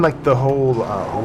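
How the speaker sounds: normal talking voice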